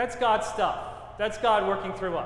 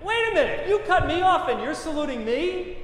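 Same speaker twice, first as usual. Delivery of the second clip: higher and louder, laughing through it; mock indignant disbelief; 7 semitones higher, 5 dB louder than usual. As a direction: play up your own outrage for laughs. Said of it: "And I'm thinking".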